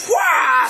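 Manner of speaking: high screamed "wah"; high hammed-up battle screech; imitated battle noise. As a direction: imitate a movie roar in a high voice.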